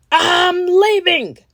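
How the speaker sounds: high, slow, loud monster cry; monster shout. Acted. A big monster fleeing kisses, slow high shout. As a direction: wail it as you flee. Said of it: "a big monster shouted".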